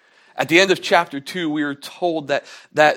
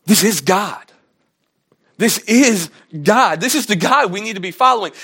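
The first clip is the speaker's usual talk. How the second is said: high, loud awestruck proclamation, slowing on the repeat; awed declaration; 4 semitones higher, 6 dB louder than usual. Retold or imitated. Sailors, stepping back after the sea calms, proclaim in high loud awe. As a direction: announce it like a revelation that stuns you.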